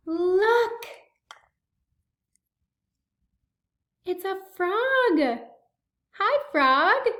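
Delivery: excited reveal. Acted. Frog revealed to child.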